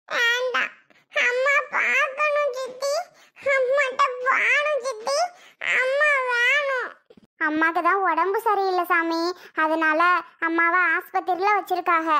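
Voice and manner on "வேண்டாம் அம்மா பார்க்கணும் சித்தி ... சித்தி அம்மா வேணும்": put-on high, whiny toddler voice; crying little child. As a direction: plead tearfully for mummy in a put-on child voice. குழந்தை அம்மாவைக் கேட்டு அழுகிறது. (அழுதுகொண்டே கெஞ்சும் குழந்தைக் குரல்)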